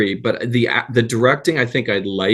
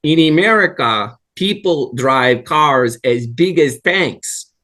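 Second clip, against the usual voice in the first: put-on slow, comic Russian accent for the joke; Yakov Smirnoff Russian accent; 4 semitones higher, slower than usual. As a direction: deliver the setup in a hammed-up Russian accent. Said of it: "this is what he sounded like".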